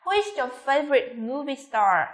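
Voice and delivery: slow wh-question, "star" falling; falling intonation. Shown as a demonstration. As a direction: let the question descend to "star". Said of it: "xuống giọng ở cuối câu".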